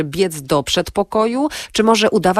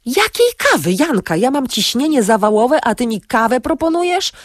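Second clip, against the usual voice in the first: high-pitched, exasperated protest; worked-up complaint, pitch soaring; indignant outburst; 7 semitones higher, 3 dB louder than usual. A narrator's reading. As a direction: reject the offer in agitated disbelief. Agitated partner rejects offer of coffee.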